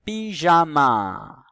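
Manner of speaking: PYJAMA loud and very slow, syllable by syllable; teacher sounding out PYJAMA; drawn-out word demo. Shown as a demonstration. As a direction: stretch PYJAMA so JA stands out. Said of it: "Est-ce qu'on entend la syllabe ja".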